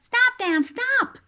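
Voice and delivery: high-pitched desperate begging; mimicking a frightened child's plea; re-enacted plea. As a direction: cry out to stop him, pitch way up. Re-enacting her plea to father.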